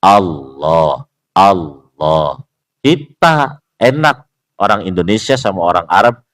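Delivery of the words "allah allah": low Allah starting from A, lips not pouted; correct heavy Allah. Shown as a demonstration. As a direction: say Allah from an open A, still sounding like O. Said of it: "mulut saya nggak monyong".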